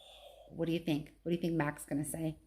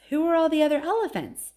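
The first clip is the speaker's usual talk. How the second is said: put-on high, quick, curious child elephant; a small calf asking, eager and high; little elephant voice; 9 semitones higher, 7 dB louder, faster than usual. Baby elephant Ruby asking Ivan about the zoo, high and eager.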